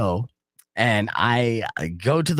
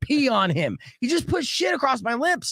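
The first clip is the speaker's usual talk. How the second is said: high-pitched mock indignation; incredulous retold complaint; imitated outraged freak-out; 11 semitones higher than usual. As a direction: act out disgusted disbelief, pitched high. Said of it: "he's freaking out, rightfully so".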